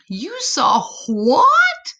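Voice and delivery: high, slow disbelieving mama voice; can't believe your ears; astonished mama. Acted. Mama hearing her son's wild tale, astonished and high.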